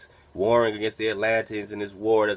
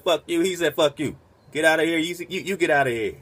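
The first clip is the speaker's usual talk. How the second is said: higher-pitched, hammed-up angry dismissal; mock angry telling-off; 6 semitones higher than usual. Imitating the Hebrew telling the Martians off in a mock angry voice. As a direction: act out an angry kick-out, mock-heated. Retelling a shouting match.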